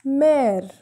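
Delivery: mère with open "eh": "mehr"; accent grave pronunciation. Shown as a demonstration. Teacher giving an example of accent grave, mère as "mehr". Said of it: "we are pronouncing mère".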